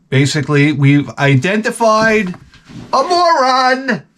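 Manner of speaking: slow, rising, drawn-out proclamation; slow sarcastic announcer; mock announcement. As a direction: drag out "a moron" high like a big reveal. Mock announcing a moron found.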